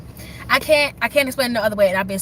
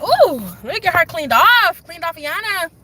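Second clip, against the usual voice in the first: excited ooh; 6 semitones higher, 3 dB louder than usual. Retold or imitated. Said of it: "And I was just like".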